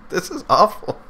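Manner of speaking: high, laughing complaint; amused disgust. Real, not acted. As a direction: laugh through the verdict.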